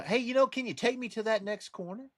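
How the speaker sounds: high-pitched wheedling request; would-be robber asking. Retold or imitated. Robber asking a driver for a lift, mock-innocent. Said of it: "they're sitting there going".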